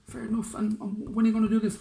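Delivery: high pitched mayor; eager high question; fussy mayor. Acted. Mayor asking the general when, high and loud.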